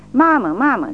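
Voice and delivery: high, loud terrified cry; child's scream. A narrator's reading. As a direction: scream for his mother. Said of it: "țipă copilașul". (țipăt de copil speriat, înalt și tare)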